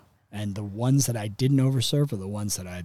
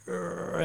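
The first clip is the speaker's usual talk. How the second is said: high mock straining grunt; sustained pretend growl of exertion; 8 semitones higher, 5 dB quieter than usual. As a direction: imitate a big man's effort growl. Biggest guy straining at arm wrestling.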